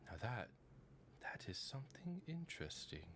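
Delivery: intrigued whisper. Acted. NPC studying the robot, whispered. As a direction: breathe it out, fascinated. NPC muses over Rat.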